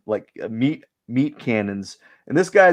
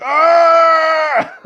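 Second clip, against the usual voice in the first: much higher, loud straining yell; mock lifting scream; 9 dB louder than usual. Bodybuilder in the photo rowing dumbbells, straining scream. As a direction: scream like you're hauling a huge dumbbell. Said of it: "And he's screaming".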